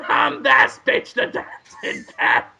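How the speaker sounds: high, raspy cartoon cat; strained, demanding cat voice; Willem Dafoe. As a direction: insist like a cranky talking cat.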